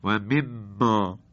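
و مما said slowly with pinched nostrils, stuffy; like someone with a cold; blocked nose. Shown as a demonstration. Teacher holding his nose to show blocked ghunna.

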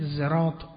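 "زراط" said with a voiced z in place of the s; z sound opening "زراط"; pronounced with z. Shown as a demonstration. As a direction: begin "زراط" on a buzzing z.

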